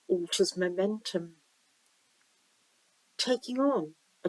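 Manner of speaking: slow-paced visualization reading with long pauses; guided meditation. Acted. Reader guiding listeners down a stream to the sea, guided meditation. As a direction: lead the listener gently, leaving room to imagine.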